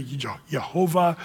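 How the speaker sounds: "Jehovah" begun with a Y sound; Y instead of J at the start of "Jehovah". Shown as a demonstration. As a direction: say "Jehovah" as yeh-HOH-vah. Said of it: "some people say".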